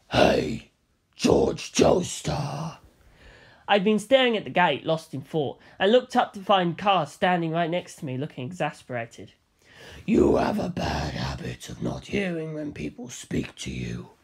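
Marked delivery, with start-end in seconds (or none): 0.1-2.8 deep, slow, menacing giant; towering fighter greets the hero in a deep slow voice; Kaz voice. A narrator's reading. Kaz calls him. 10.1-14.1 deep, slow, menacing giant; towering fighter greets the hero in a deep slow voice; Kaz voice. A narrator's reading. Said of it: "he said".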